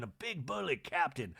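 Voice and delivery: ordinary delivery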